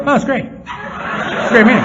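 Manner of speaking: a forced, laughing answer, slowing on the second line; laughing through it. Retold or imitated. Newcomer answering a stranger after his first meeting, laughing. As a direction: chuckle out the praise to hide how lost you feel. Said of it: "And I go".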